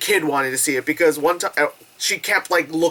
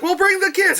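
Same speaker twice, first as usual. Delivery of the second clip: high mock parent voice; chirpy quoted parent; 13 semitones higher than usual. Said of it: "So they're like".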